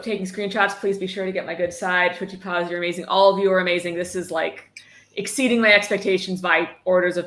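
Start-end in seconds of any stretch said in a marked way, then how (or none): none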